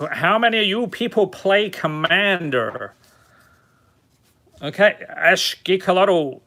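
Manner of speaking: put-on retro American sales patter; fake American accent. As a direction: pitch it like an American car salesman. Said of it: "my fake American accent. Uh, what is it? Fifties car salesman".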